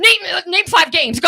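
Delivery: high, loud mock quizmaster demand; challenging gamer dudes. Male customer testing female game clerk, loud mock challenge. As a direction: imitate a customer demanding proof, loudly. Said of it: "dudes would walk in and be like".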